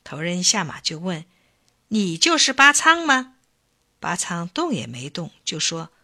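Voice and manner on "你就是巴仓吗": lightly voiced chief, higher-pitched question. A narrator's reading. Chief dismounts to ask for Bacang, questioning. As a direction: ask it high, as a chief sizing someone up.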